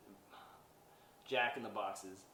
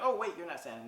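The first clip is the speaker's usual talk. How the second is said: excited Buddy; 6 semitones higher, 6 dB louder, faster than usual. Retold or imitated. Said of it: "He's like".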